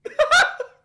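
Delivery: high shrieking laugh; loud burst of laughter. Real, not acted. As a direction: explode into a squealing laugh. Laughs at Chadio name.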